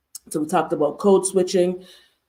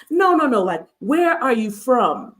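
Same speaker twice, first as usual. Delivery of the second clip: mock impatient prying, drawn out at the end; dismissive insistence; 5 semitones higher than usual. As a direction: imitate a pushy stranger rejecting the answer. Runner rejects her answer.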